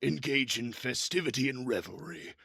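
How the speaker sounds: big bruiser making an entrance; armored giant Glurg. Acted. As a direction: boom out the name, then announce grandly. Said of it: "as he walks up, he goes".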